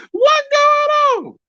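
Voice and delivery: very high, slow, stretched squeaky voice; chopper voice. Pretend. Streamer asking chat about StreamYard, in a squeaky chopper voice. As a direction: drag it out in a high cartoon squeak. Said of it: "chopper voice".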